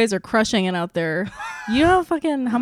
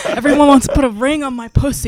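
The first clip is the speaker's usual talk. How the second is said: laughing brag; 4 semitones higher, 7 dB louder than usual. Real, not acted. Comic brag while laughing.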